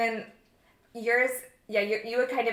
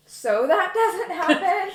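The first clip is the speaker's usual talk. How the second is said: laughing; 9 semitones higher, 6 dB louder, slower than usual. Real, not acted. Laughing at her own outbursts.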